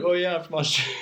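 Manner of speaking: retold fake Australian accent; lightly done Australian accent. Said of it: "pretended to be Australian the whole time".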